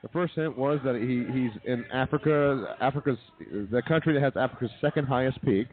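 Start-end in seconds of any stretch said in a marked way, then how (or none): none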